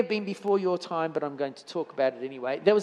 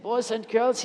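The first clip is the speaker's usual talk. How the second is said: put-on lightly German-accented English, higher pitch; a German speaker addressing students; 6 semitones higher than usual. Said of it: "forgive my German accent".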